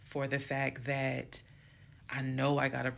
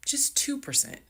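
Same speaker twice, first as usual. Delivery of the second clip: "Just two percent" pushed way up high, incredulous; emphatic; 12 semitones higher than usual. Real, not acted. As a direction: stress it up high, disbelieving. Emphatic exasperated aside.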